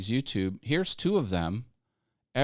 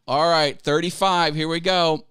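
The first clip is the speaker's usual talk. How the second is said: loud, high rallying call; hyped-up countdown to action; revved-up announcement; 4 semitones higher, 6 dB louder than usual. Real, not acted. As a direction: kick it off with a raised, punchy voice. Launching the promised button pushes.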